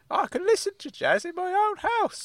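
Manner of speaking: high-pitched, worked-up uncle; Uncle Claude, worked up. Acted. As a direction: protest in a high comic character voice. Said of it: "why are you getting so worked up".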